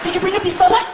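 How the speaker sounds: very high mock-female voice; telenovela heroine. Comic plays novela scene with soldier, high dramatic voice. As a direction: call out like a soap-opera lady. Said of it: "you're in one of those novelas".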